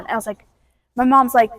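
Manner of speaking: plain reading voice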